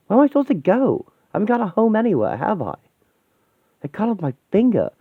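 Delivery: high, agitated, panicked ranting; desperate breakdown. Acted. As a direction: unravel until the words give out. Distraught character breaks down.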